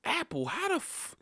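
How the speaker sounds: high, squeaky disbelief at Apple; shrill-sounding cry of disbelief at the phone; exasperated disbelief. Retold or imitated. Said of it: "So I'm like".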